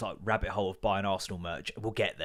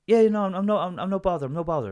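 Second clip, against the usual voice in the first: high-pitched Irish reassurance; Irish accent; 7 semitones higher, 4 dB louder than usual. Retold or imitated. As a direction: imitate an Irishman stammering that he's harmless. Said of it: "I shouldn't do the accent".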